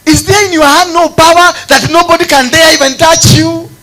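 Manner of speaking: loud, high shouted sermon; full-force preaching. Acted. Preacher challenging God with the people's problem, shouted fervently. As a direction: thunder it out high, driving hard at God.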